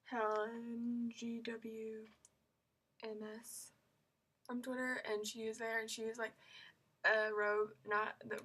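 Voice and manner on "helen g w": normal talking voice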